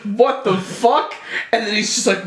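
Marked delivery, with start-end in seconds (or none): 0.0-1.1 shocked what the fuck. Retold or imitated. Characters react to shocking sight.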